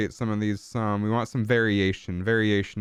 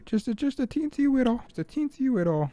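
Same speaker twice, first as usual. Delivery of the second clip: put-on tiny high-pitched baby voice; baby talk. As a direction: squeak it like mock baby talk.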